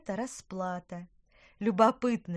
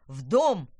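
loud, low fatherly command; commanding shout; 3 semitones lower, 10 dB louder than usual. Acted. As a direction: bark the order to get inside. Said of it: "скомандовал папа".

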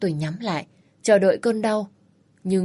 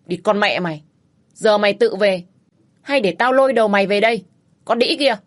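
cursing rage; 4 dB louder than usual. Acted. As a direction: spit the curses at her, building to a scream. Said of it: "Hắn chửi tôi".

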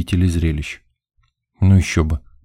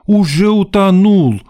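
high, loud hysterical wail; indignant; 14 semitones higher, 6 dB louder than usual. A narrator's reading. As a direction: overwrought diva piling on the drama. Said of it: "возмутилась свидетельница".

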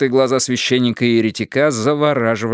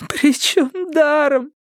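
very high, slow, tearful wail; drawn-out crying squeal; sobbing; slower than usual. A narrator's reading. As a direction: sob the words out miserably. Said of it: "рыдая".

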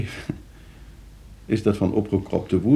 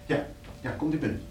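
hushed welcome; 3 semitones higher, 10 dB quieter, faster than usual. Acted. Stiekeme ontvangst van bezoeker.

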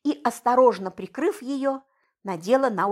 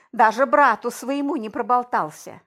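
ordinary delivery, nothing marked

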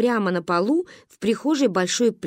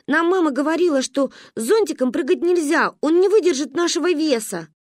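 put-on child arguing; 6 semitones higher than usual. Child argues citing her mother.

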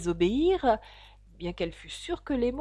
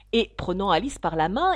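ordinary delivery

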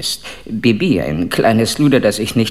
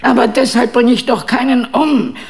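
much higher and louder, protesting; indignant outburst; 14 semitones higher, 4 dB louder than usual. Acted. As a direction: burst out in outrage at the suspicion. Empörter Ausruf gegen Verdacht. (empörter, lauter Protest)

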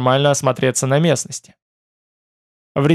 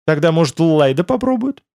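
curious question, pitched up at the end; interested; 4 semitones higher than usual. A narrator's reading. Тмор prompts his friends to explain, with noticeable interest. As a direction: ask with mild, growing interest. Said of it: "Уже с заметным интересом спросил".

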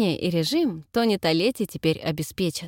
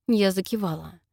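plain reading voice

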